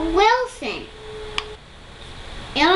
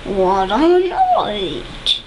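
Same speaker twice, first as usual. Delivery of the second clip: mole muttering; 3 dB louder, much slower than usual. Acted. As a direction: drag the words out like a grumpy mole. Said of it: "Mole mutters".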